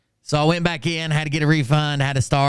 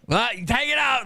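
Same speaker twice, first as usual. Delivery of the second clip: mock loud shout; 12 semitones higher than usual. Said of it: "loud as fuck".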